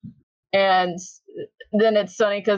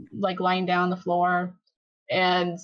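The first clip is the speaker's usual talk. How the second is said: usual speaking voice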